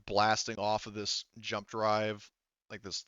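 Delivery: ordinary talk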